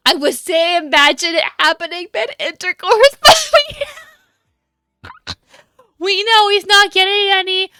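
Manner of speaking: talking through laughter, pitch climbing; cracking up. Real, not acted. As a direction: gasp and laugh your way through the joke. Cracking up at own joke.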